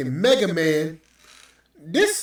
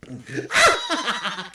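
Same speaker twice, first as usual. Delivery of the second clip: laugh; 9 semitones higher than usual. Real, not acted. Laughing at own joke.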